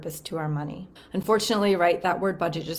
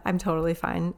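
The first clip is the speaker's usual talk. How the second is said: ordinary delivery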